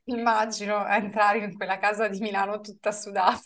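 normal talking voice

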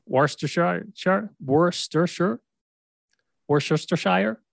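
high, questioning tries at Worcestershire; unsure pronunciation attempts. Real, not acted. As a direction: test Worcestershire with "shire" and "sher" endings, each rising. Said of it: "how do you say this".

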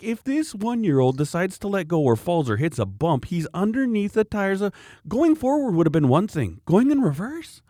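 high, worked-up telling-off; agitated scolding. Retold or imitated. Parent telling grandpa the toddler could go under, re-enacted agitated scolding.

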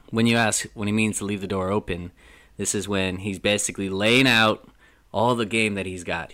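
ordinary talk, nothing marked

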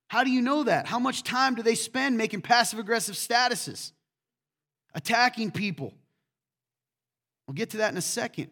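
high, loud, driving sermon voice; fervent preaching. Acted. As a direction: preach it with fire to the congregation. Preaches at full force.

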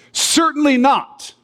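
CERTAINLY NOT pitched high and drawn out; emphatic. Acted. Preacher voices Paul's answer to a stupid objection, emphatic. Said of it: "let's just say that's an emphatic".

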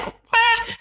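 high squeaky laughing disbelief; laughing, astonished so it's like what; incredulous what. Real, not acted. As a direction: pitch way up in amused disbelief. Amazed at grandmother's workload.

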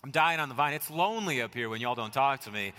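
ordinary talk, nothing marked

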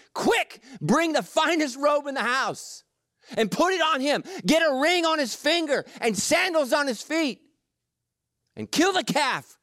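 high, eager calls to the servants; a glad father hurrying the servants along; excited father. Acted. Father calling to his servants, high and eager.

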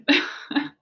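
short unvoiced laugh; breathy laugh. Real, not acted. Laughing about covid postponing the wedding, airy laugh. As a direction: let out a quick airy laugh.

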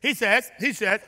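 normal talking voice

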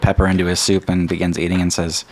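normal talking voice

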